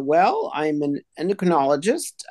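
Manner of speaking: ordinary talk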